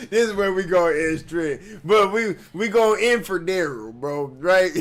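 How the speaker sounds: loud words through laughter; laughing loudly mid-sentence. Real, not acted. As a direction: laugh while talking.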